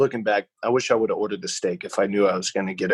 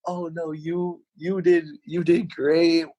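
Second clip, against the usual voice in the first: mock reassuring server; 6 semitones higher, slower than usual. Server reassuring at bill's end.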